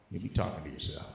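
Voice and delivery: normal talking voice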